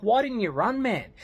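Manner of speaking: high, disbelieving demand; retold exasperation; exasperated challenge. Acted challenge to friend's story.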